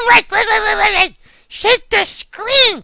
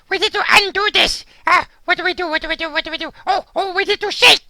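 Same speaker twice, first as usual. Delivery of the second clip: ordinary talk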